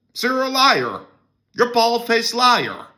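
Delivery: very high, hammed-up indignant accusation; mock accusation. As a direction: squeal it like a shocked accuser. Accusing the article's author.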